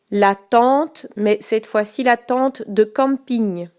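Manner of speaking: slow, carefully articulated dictation. Shown as a demonstration. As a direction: say each word clearly for spelling. Dictating each word twice.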